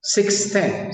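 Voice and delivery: "six ten" en inglés, alto y claro. Shown as a demonstration. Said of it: "Pero yo también puedo decir". ("six ten" pronunciado en inglés)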